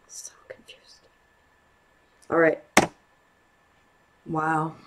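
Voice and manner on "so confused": soft breathy whisper to yourself. Real, not acted. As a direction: barely voice it, puzzled. Muttering to self while watching.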